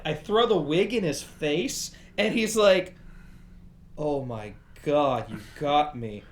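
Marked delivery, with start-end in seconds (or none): 4.0-6.2 numb. Retold or imitated. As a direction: say it stunned, with nothing left. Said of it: "yeah, he was numb".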